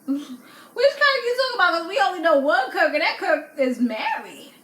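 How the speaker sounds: high, loud disbelieving outcry, settling down; incredulous outcry. Retold or imitated. Cast shocked to hear Kirk named, loud incredulous outcry. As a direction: cry out which Kirk in disbelief.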